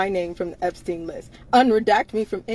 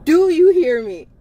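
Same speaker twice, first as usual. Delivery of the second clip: loud, higher, insistent question; exasperated demand; 6 semitones higher, 6 dB louder than usual. Real, not acted. Woman demanding to know she is heard, exasperated and loud. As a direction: demand an answer, voice raised.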